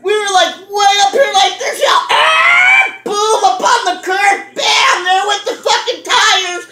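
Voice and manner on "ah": long, rising high-pitched "aah"; imitated screech. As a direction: mimic a screeching truck with a drawn-out cry. Acts out the truck mounting curb.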